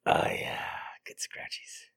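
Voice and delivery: soft unvoiced whisper. Real, not acted. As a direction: breathe the words in a whisper. Whispered lines.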